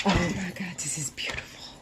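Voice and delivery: hushed, breath-only amazement; awed whisper. Real, not acted. Vlogger admiring the wrapped planner, awed whisper. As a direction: whisper it in real awe.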